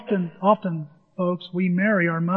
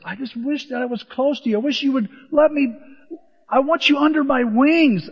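longing plea; 7 semitones higher than usual. Acted. Acting God longing like mother hen.

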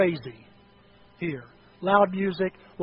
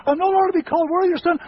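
desperate pleading; 10 semitones higher, 9 dB louder, much faster than usual. Acted. Prodigal son pleading with father.

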